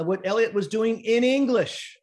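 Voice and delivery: normal talking voice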